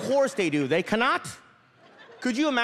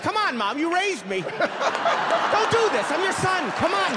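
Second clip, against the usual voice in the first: higher, whiny-son protest building in pitch; exasperated pleading; 9 semitones higher, slower than usual. Acted. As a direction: plead with your mom, played up. Son pleading with his snitching mother.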